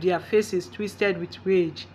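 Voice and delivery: usual speaking voice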